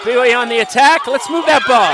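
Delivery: loud, fast, urgent call of the attack; excited play-by-play. Real, not acted.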